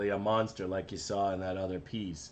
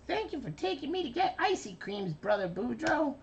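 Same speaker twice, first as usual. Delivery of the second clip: very high childlike cartoon voice; high-pitched little brother cartoon voice; Carbonate voice. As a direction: squeak it out like a small sweet cartoon kid. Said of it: "Carbonate says".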